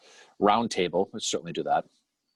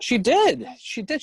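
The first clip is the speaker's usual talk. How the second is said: excited; slower than usual. Real, not acted. Excited praise.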